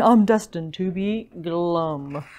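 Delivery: slow, low, doomed mopey fish; mock glum. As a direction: drag the last word down into gloom. Glum fish resigns himself.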